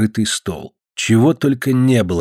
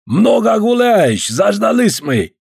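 put-on slow, higher, drawn-out Caucasian accent; 10 semitones higher, 3 dB louder, slower than usual. Georgian guest chides the late host, slow Caucasian accent. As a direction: stretch each word with a heavy Caucasian accent. Georgian visitor voiced by reader.

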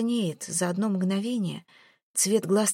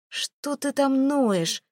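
higher, slow and contemptuous; mocking Jared; 6 semitones higher, much slower than usual. A narrator's reading. Jared scorning Mel's begging, slow contemptuous sneer. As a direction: drag the sneer out slowly. Jared mocks her pleading.

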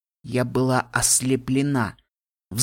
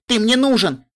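high and loud plea; desperate call; 10 semitones higher, 6 dB louder than usual. A narrator's reading. Littlepip begging Spike to come, shouted high and loud. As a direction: call out urgently for help. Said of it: "закричала я".

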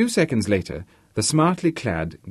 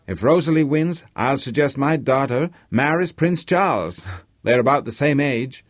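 higher-pitched, eager Polish-American horse owner; boastful American host; 4 semitones higher than usual. Acted. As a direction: play an excited owner dreaming of victory. Narrator voices Harvey boasting of winning.

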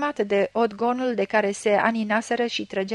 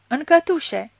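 higher, louder shout on cătușe; shouted again; 6 semitones higher, 5 dB louder, slower than usual. A narrator's reading. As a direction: throw the word cătușe out as a shout. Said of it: "zbieră la Gradora".

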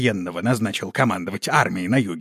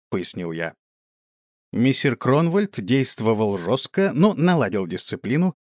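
ordinary delivery

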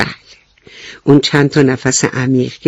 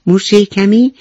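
plain reading voice

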